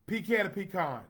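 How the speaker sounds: first pecan as pee-CAN, second pecan as pee-KAHN; pecan ending like 'can', then pecan ending like 'con'; both pronunciations contrasted. Shown as a demonstration. Host asks viewers to choose, pee-CAN then pee-KAHN. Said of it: "I'm putting it out there".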